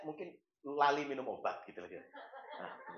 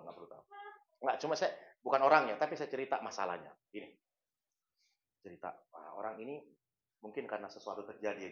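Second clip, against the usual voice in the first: ordinary delivery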